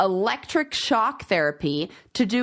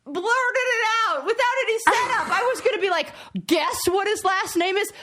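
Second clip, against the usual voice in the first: high, laughing self-scolding; laughing excitedly; 10 semitones higher than usual. Real, not acted. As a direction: laugh at your own blunder, worked up. Still worked up after blurting.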